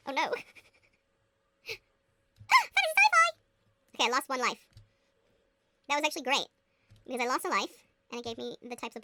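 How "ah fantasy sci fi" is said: very high, loud burst of joy; real thrilled outburst; excited shriek. Real, not acted. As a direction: gasp and squeal at the find. Finds wanted book.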